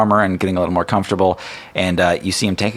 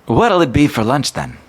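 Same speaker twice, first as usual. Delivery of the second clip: high cheery cook; 8 semitones higher, 4 dB louder than usual. Acted. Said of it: "Megan says".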